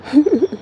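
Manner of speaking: short, high chuckle; real chuckle. Real, not acted. Vlogger amused while tending plants, short chuckle. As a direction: let out a quick little giggle.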